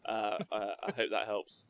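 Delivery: ordinary talk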